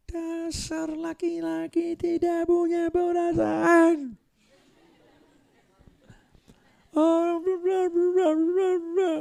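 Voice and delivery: high, slow, scornful mock wife voice; mock the sulking wife in a high whine; nagging wife. Wife scolding husband in sermon. (istri mengomel dengan suara tinggi yang dibuat-buat)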